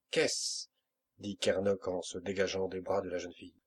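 plain reading voice

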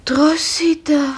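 pleading whisper. Acted. Kranke ruft schwach.

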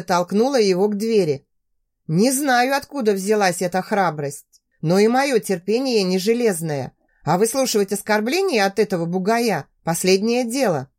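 usual speaking voice

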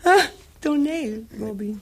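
high, loud, slow mock-grand sigh; theatrical mockery. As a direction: ham up the word like a diva. [name] mocks the theatre.

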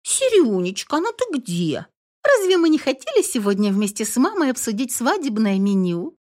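put-on high chirpy sugary girl voice; cartoon princess. A narrator's reading. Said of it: "прощебетала девушка".

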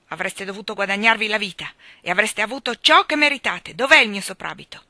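loud gruff scolding. A narrator's reading. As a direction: snap at the kids. Husband scolding children. (rimprovero brusco e forte)

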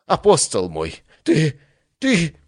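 sputtering high theatrical god; wild overacting. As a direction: overplay the outrage, stammering.

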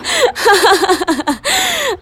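high, breathless burst of laughter; laughing hard. Real, not acted. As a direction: laugh till it almost turns to tears. Laughing at friend's embarrassment. (risa fuerte casi llorando)

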